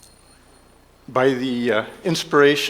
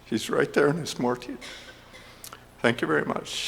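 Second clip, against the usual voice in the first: ordinary talk, nothing marked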